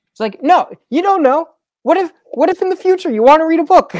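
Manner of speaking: very high, fast, exasperated; exasperated rant. Real, not acted. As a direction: plead with disbelief, rushing.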